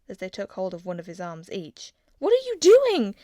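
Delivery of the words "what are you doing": high, loud startled cry; alarmed question. A narrator's reading. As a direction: protest as guards grab you. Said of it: "he asked".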